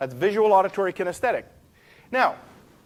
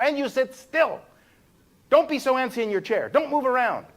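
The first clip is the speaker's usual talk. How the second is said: higher and a bit louder nagging teacher; strict teacher; 8 semitones higher, 3 dB louder than usual. Retold or imitated. Imitating a teacher telling antsy pupils to sit still, mock scolding. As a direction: scold the fidgety kids like a stern teacher.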